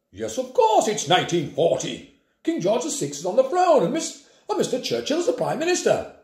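a hint of a proud old airman, insisting; lightly voiced old man. A narrator's reading. Grandpa correcting the class, lightly voiced and sure. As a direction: state it as plain fact, a touch lofty.

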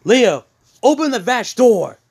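high, loud acted order; commanding shout in character. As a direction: call out the order sharply to an underling. Cinder orders Leo, acted.

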